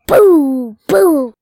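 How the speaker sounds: loud playful boo; jump-scare boo, shouted; spooky boo. Pretend. Spooky segment intro.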